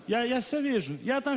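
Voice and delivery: ordinary talk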